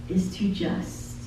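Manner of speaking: fading to a soft breath; trailing into a hush. Acted. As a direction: let it die away softly. Lyric refrain fading to hush.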